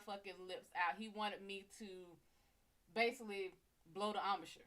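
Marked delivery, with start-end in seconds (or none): none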